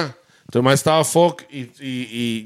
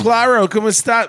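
higher-pitched mock gringo Spanish; gringo accent; 6 semitones higher than usual. Showing how gringos speak in Costa Rica, higher gringo accent. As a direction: imitate an American's accent in Spanish. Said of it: "con el acento gringo".

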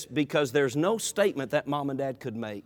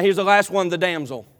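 normal talking voice

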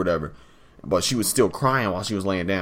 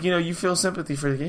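ordinary delivery

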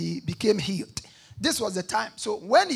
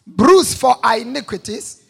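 louder, meaning every word; read with conviction; 11 dB louder than usual. Shown as a demonstration. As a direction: proclaim it like you mean it. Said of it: "oh, read it like you mean it".

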